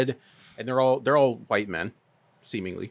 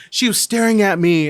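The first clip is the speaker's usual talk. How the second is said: higher, louder whining imitation; mock whiny man; 9 semitones higher, 7 dB louder than usual. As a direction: mimic the petulant complaint. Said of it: "he was like".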